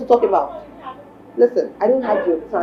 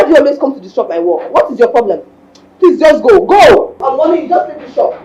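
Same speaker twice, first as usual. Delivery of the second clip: loud, rising angry outburst; furious woman shouting at her ex to leave; 4 semitones higher, 6 dB louder than usual. Acted. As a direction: order him out of the shop, shouting. Shop owner throwing ex out.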